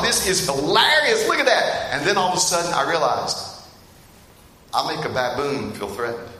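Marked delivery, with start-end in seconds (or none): 0.0-1.9 amused. Retold or imitated. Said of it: "We're like".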